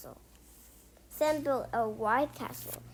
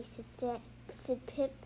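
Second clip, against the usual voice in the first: ordinary delivery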